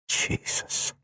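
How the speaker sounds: breathless unvoiced whisper of surrender; hushed awed whisper. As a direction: breathe the name out. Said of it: "The way he said it".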